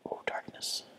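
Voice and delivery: hushed whisper. Real, not acted. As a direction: whisper it cautiously. Whispered in dark area.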